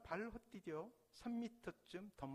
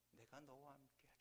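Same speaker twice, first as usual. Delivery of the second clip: hushed, unvoiced whisper of the Lord's words; whispered promise; 3 semitones lower, 13 dB quieter than usual. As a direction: breathe it like a gentle promise. Quoting the Lord softly. (속삭이는 주님의 약속)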